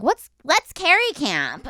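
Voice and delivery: a hint of wide-eyed teen; naive girl. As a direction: innocent girlish question, slow. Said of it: "And I was like".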